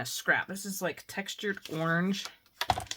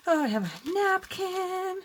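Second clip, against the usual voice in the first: much higher, drawn-out oh; delighted surprise; 12 semitones higher, 3 dB louder, slower than usual. Real, not acted. Crafter surprised to find a napkin in her stash. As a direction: sing out the discovery.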